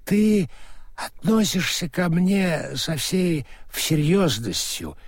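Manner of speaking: put-on slow, maudlin drunken flattery; drunk, gushing with affection; drunk sentimental. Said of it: "пьяной сентиментальности".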